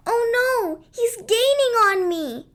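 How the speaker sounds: high, panicked call; frightened cry. Acted. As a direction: cry out while fleeing. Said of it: "Shimmer cried".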